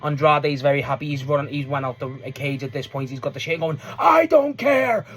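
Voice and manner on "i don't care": high, loud, stretched imitated yell; mock defiant shouting. Imitating Andrade leaving the cage repeating himself, defiant shout. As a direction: holler it defiantly over and over. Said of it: "All just repeating himself".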